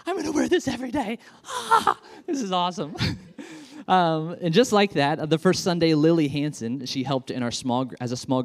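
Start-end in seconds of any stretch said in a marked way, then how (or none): 0.1-2.9 put-on high, breathless little kid voice; delighted child gushing; excited kid. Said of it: "the kid will be like". 3.0-3.8 giggle. Retold or imitated. Kid impression giggle.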